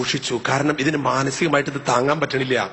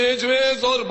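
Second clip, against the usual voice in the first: put-on fervent child prayer; 12 semitones higher than usual. Child praying in church, high and fervent. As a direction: pray like an eager child. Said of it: "ഭയങ്കര പ്രാർത്ഥന".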